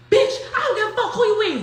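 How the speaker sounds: fast, high, loud mock yell with a laugh in it; retold angry yell. Arguing from the front seat, fast and loud with a laugh. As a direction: fire it off like you're back in the argument, laughing. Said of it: "I'm like".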